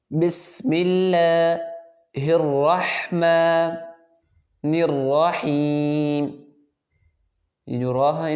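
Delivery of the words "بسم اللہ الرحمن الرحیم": slow melodic tajweed chanting; Quran recitation. Shown as a demonstration. Teacher reciting a verse for students to repeat, melodic. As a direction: recite it slowly in a chanting tune.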